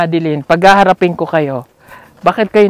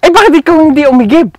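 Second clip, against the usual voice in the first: scolding; 10 semitones higher, 9 dB louder, faster than usual. Real, not acted. Teacher scolding, fast and high.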